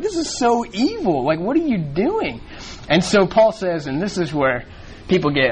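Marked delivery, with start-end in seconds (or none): 0.0-2.4 higher, slow, appalled; indignant disbelief. Retold or imitated. Paul scolding the Corinthian church, slow appalled indignation. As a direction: drag it out like you can't believe what you're seeing. Voicing Paul's outrage at sin.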